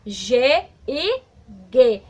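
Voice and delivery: teacher contrasting G sounds; two sounds of G. Shown as a demonstration. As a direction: say "G e G." showing both sounds. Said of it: "ela tem dois sons".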